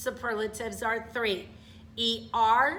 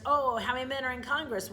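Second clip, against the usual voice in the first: ordinary talk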